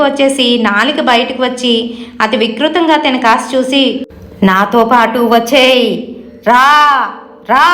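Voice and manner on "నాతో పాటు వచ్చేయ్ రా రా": slow, drawn-out demonic calling; ghostly shouted summons. A narrator's reading. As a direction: summon your victim with long, stretched words, never truly shouting. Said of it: "గట్టిగా అరిచి చెప్తుంది". (దెయ్యంలా సాగదీస్తూ పిలుపు)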